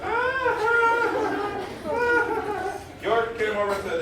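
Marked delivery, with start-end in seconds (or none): 0.0-2.7 put-on very high baby cries of "ah, ah, ah"; squeaky infant "ah" sounds; baby babbling. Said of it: "just went".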